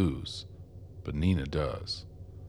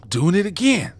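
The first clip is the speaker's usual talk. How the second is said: alarmed realization; 14 semitones higher, 9 dB louder than usual. Acted. Realizes he is still broadcasting.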